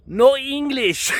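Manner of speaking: slow, heavy foreign accent, playing dumb; grandmother's broken English. Grandmother brushing off pushy people, in a slow foreign accent. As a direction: drag it out like you can't speak English. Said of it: "she used to say".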